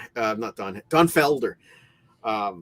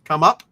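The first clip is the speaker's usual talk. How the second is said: "Come up." with a louder rising "up"; punning emphasis; 3 semitones higher, 10 dB louder than usual. Shown as a demonstration. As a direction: push "up" louder to land the pun. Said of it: "Get it?".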